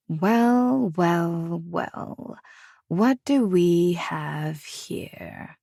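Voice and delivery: slow, sneering woman's voice, drawn out; biting mock greeting. A narrator's reading. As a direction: savour each well like a cat toying with prey. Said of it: "The biting words".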